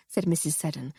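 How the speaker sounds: ordinary delivery, nothing marked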